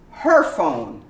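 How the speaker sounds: "her" fully sounded with the H; full her. Shown as a demonstration. Teacher modelling full "her" opening a sentence. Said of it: "it's pronounced completely".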